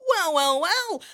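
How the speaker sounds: well well well, rising high on the last; showy host exclaim. Acted. Host greeting a contestant with mock delight. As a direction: gameshow host delight, pitching way up.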